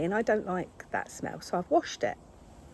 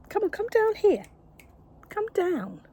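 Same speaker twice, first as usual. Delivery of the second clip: much higher and louder, coaxing call; calling the cat down; 12 semitones higher, 5 dB louder than usual. Real, not acted. Owner calling her cat down off the table, high and loud.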